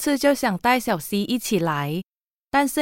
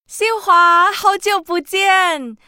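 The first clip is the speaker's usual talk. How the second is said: high, loud and slow greeting; excited reunion; 9 semitones higher, 4 dB louder, slower than usual. Acted. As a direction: greet an old friend with joy. Reunion at airport.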